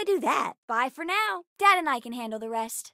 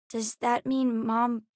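usual speaking voice

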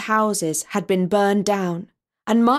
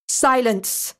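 sharp one-word order, only slightly raised; commanding cry; 3 semitones higher than usual. A narrator's reading. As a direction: order the creature to stop. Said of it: "cried Rabbi Lion".

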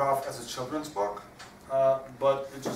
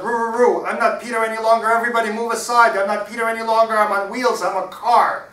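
high, full-out holler; Peter hollering; 10 semitones higher, 15 dB louder than usual. Acted. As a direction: shout it fast, like a boy playing at being a vehicle. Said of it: "and he hollered".

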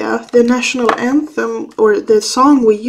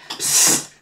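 soft unvoiced psst hiss; psst at cat; 4 dB quieter than usual. Real, not acted. Owner hissing again at the clawing cat, soft psst.

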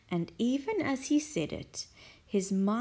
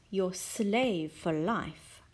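savoured echo; slower than usual. Acted. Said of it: "shiver of excitement".

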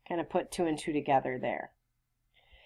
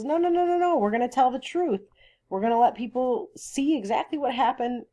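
high-pitched run of protesting no's; mock insistence; 8 semitones higher, 3 dB louder than usual. Teacher paraphrasing Atticus refusing a cover-up, imitated insistence. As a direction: wave the idea away, higher and emphatic.

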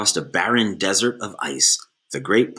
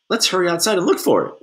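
put-on high, fast eager child; urgent; 9 semitones higher, 4 dB louder, much faster than usual. A narrator's reading. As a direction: rush it out in alarm. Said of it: "one of the children said".

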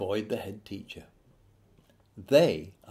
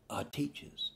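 whispered reveal; 4 semitones higher, 11 dB quieter than usual. Acted. Narrator confiding the grim truth to parents in a whisper. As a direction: drop to a hushed whisper for the punchline. Punchline reveal of the staff room.

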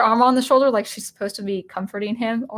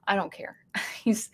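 whispered aside; 3 semitones lower, 8 dB quieter than usual. Real, not acted. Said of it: "aside on complaint".

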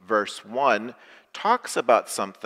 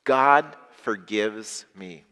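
"God forgives me" said very slowly and louder; slow, emphatic repeat; 5 dB louder, much slower than usual. Shown as a demonstration. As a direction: lead them in each word. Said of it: "Say that with me".

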